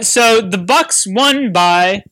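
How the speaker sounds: slow, deliberate, for an audio clip; slow announcer read. Shown as a demonstration. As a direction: spell it out slowly like a recorded line. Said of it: "All right, guys, you ready?".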